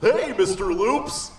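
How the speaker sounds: slow, friendly bear voice; a lightly voiced talking bear. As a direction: greet him like a big gentle bear. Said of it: "the bear said".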